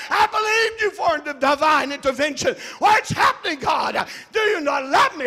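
shouted, anguished questions at God; troubled believer calling on God; desperate plea to God. Acted. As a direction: cry out to heaven, feeling abandoned. Pleading to God, acted.

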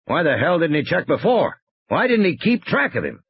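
irritated game warden grumbling about a colleague; exasperated Muldoon. Acted. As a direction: demand answers, pitch climbing.

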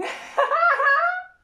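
high shrieking burst of laughter. Real, not acted. Laughing at her own photo, shrieking. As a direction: let out a squealing laugh.

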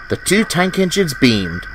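ordinary delivery

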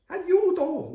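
high, loud storybook yell; shouted group alarm. A narrator's reading. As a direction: shout for help as the whole frightened gang. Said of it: "urlano tutti gli altri". (urlo acuto di tutti gli amici)

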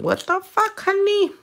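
squeaky-high exasperated question; mock exasperation. Real, not acted. Nail tech fussing at a crooked nail form, mock exasperated. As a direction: jump way up in pitch, playfully exasperated.

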